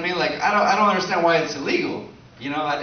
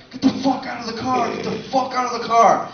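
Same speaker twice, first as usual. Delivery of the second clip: mock road rage yell; 8 semitones higher than usual. Drunk guy yelling at car.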